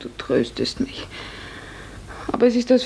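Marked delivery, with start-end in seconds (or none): 0.0-1.1 low, soft and slow, sadly; sad murmur. Acted. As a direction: let it sink, a quiet wounded murmur. Heartbroken reply to comfort.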